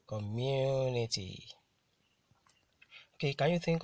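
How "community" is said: "community" very slow, MU held long and the ending falling; drawn-out teacher's coMMUnity, weight on MU; stress on MU. Shown as a demonstration. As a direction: say "community" for learners, stressing the second syllable MU. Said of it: "I T Y are usually stressed".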